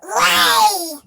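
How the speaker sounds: a loud one-word command; giant's shout. A narrator's reading. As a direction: bark the order at the hen. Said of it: "the giant shouted".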